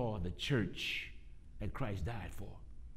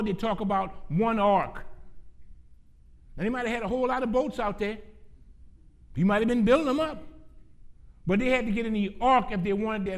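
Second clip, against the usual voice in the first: loud, high, emphatic preaching; full-force preaching; 10 semitones higher, 8 dB louder than usual. Real, not acted. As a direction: drive it home to the congregation, loud and high.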